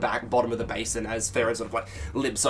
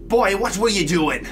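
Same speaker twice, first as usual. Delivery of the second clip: put-on gruff old sailor; 8 semitones higher, 7 dB louder than usual. Theron scolds after sigh.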